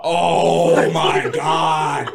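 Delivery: loud, hugely drawn-out awe; awestruck exclamation. Real, not acted. Bragging to friends about the girl, loudly awestruck. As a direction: stretch every word out loudly. Storyteller exclaims over a memory.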